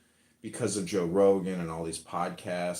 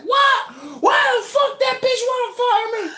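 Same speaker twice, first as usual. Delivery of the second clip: much higher, much louder enraged yell; furious outburst; 12 dB louder than usual. Retold or imitated. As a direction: explode at her, screaming. Fired worker explodes at boss.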